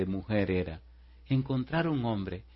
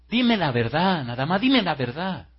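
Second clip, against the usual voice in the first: high, loud, swooping plea; insistent demand; 6 semitones higher, 6 dB louder than usual. Retold or imitated. Girl presses the man for the truth, insistent. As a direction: press hard, stretching each demand.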